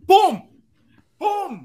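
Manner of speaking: sudden loud high boom; emphatic boom. Real, not acted. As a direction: slam it down like instant service. Service arrives instantly.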